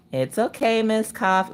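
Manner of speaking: imitating a hushed reassurance; calming. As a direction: gently talk someone down. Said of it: "she tries to calm me down".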